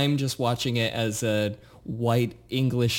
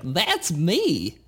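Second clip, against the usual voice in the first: squeaky cartoon kid voice, a bit higher; Butters voice; 4 semitones higher than usual. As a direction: mimic a nasal little cartoon boy. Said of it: "nasally and kind of annoying but cute little kid".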